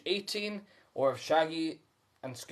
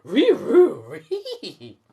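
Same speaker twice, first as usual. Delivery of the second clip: high, loud Scooby-Doo yelp; 10 semitones higher, 11 dB louder, much slower than usual. A narrator's reading. Scooby agreeing with Shaggy in a high dog voice. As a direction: bark the words like a cartoon dog. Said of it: "Scooby agrees with a sigh".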